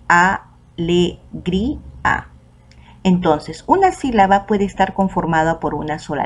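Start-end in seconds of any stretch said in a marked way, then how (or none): 0.1-2.3 "a, le, gri, a" one syllable at a time, pauses between; split into syllables. Shown as a demonstration. Teacher dividing the word for students, syllable by syllable. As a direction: break "a, le, gri, a" into separate beats. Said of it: "se ha dividido en".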